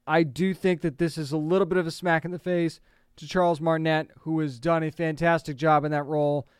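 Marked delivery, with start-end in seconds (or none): none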